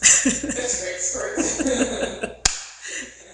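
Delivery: high breathy laughing fit; real giggling fit; burst of laughter. Real, not acted. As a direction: crack up laughing. Laughing at her own joke.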